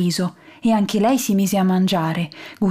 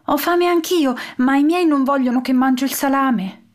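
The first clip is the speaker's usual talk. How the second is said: lightly voiced girl; 5 semitones higher than usual. A narrator's reading. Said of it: "che poi disse".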